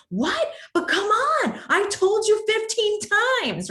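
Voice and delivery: high, loud exasperated complaint; exasperated quote. Retold or imitated. To someone who won't listen, exasperated. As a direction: pitch it up like you're fed up.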